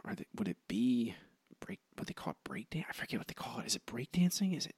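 whispering to himself while recalling; hushed, thinking aloud under the breath; searching memory in a whisper. Real, not acted.

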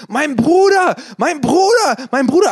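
high, loud mock yell; nachgeahmtes Schreien. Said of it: "sie schreit so". (nachgemachtes hohes Schreien)

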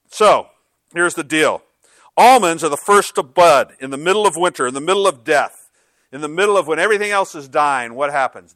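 loud, rising preaching; preaching at full force. Acted. Pastor preaching about almonds blooming, loud and rising. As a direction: drive the point home to the room.

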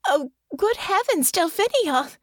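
a high cry of horror; horrified. Acted. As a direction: gasp it out in dismay. Honor's horrified realization.

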